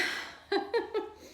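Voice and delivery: high breathy giggle; giddy laugh. Real, not acted. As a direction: laugh giddily.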